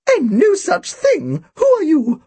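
put-on thin, very high indignant old woman; high-pitched elderly indignation; Missus Bodega. A narrator's reading. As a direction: protest like an affronted birdlike old lady. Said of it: "Her voice was thin and high-pitched".

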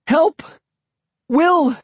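high-pitched cries for help; desperate, shrill calling; calling desperately. A narrator's reading. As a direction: call out frantically, pitched very high. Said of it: "I called desperately".

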